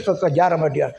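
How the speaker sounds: ordinary talk, nothing marked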